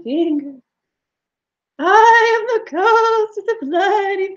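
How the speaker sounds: slow, high wailing ghost, rising each time. Acted. Ghost haunts older couple.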